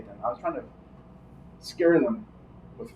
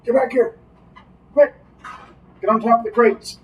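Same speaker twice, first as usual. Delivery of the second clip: yelling; 9 semitones higher than usual. Acted. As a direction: yell a warning. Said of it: "I'll yell".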